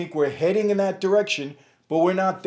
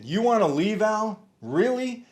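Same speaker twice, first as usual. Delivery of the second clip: rising, higher disbelieving jab at Al; incredulous; 6 semitones higher, 3 dB louder than usual. Real, not acted. Host mocks Al Sharpton for wanting out, incredulous higher pitch. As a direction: scoff at him as if it can't be true. Host scoffs at celebrity leaving.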